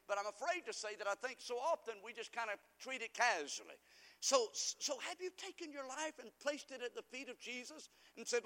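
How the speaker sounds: usual speaking voice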